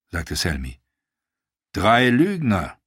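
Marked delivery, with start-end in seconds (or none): 1.7-2.8 slow, dark and dismissive; grim sellsword contradicting the old knight; grim retort. Acted. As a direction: spit out the accusation slowly. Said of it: "entgegnete Dario düster".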